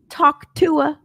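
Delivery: ordinary delivery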